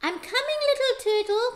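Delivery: sung call. A narrator's reading. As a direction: call to him across the sand. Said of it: "called the sea".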